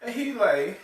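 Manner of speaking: usual speaking voice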